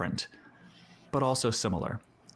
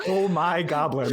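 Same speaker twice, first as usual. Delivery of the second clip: laughing; 3 semitones higher, 5 dB louder, slower than usual. Real, not acted. Laughing exclamation.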